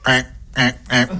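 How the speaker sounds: short, spaced duck quacks; imitated nasal quacking of a duck. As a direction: quack three times like a duck. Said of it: "il y a un canard".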